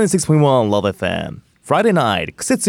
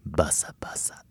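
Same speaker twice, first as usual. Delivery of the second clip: whispered fan flaps; 6 semitones lower, 14 dB quieter than usual. Acted. Fanning sound effect in skit.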